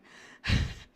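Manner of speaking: loud breathy laugh; burst of laughter. Real, not acted. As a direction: crack up at the joke.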